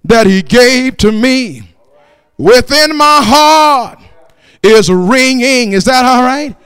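loud, chanted sermon cadence; rhythmic, fervent pulpit proclamation; full-force preaching. Acted. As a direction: preach it full voice with drawn-out phrase endings.

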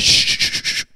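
breath-only shushing; long hushing shushes. Acted. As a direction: hush them with repeated whispered shushes. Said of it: "the birds said".

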